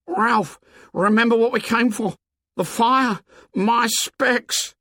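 put-on very high, anxious boy's voice; high-pitched, desperate reminder; urgent plea. A narrator's reading. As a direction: beg for his attention in a high, urgent voice. Piggy urgently seeks Ralph's attention.

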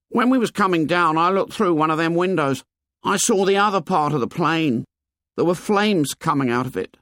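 put-on high-pitched, earnest boy's voice; high boyish character voice; fat schoolboy. A narrator's reading. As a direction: play an anxious plump schoolboy, pitched high.

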